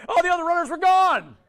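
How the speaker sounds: high, loud laughing exclamation; amused shout, tickled by the mishap. Real, not acted. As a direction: burst out laughing through the punchline. Laughs telling the track meet mishap.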